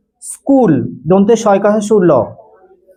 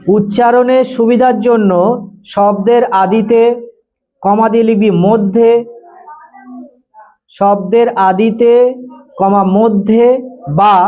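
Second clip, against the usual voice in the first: slow dictation; slower than usual. Shown as a demonstration. Dictating definition to class.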